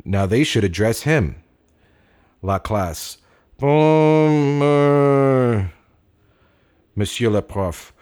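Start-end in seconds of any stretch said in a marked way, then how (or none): 3.6-5.7 high, loud, drawn-out hesitation; a teacher not satisfied with the answer; old teacher hesitating. Acted.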